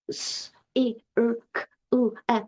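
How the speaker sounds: letter sounds one by one, separated; sounding out letters. Retold or imitated. Child meeting a word in a book, sounding it out. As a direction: sound out each letter on its own, softly. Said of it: "sound every letter out".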